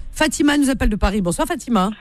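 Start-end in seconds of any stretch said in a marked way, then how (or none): none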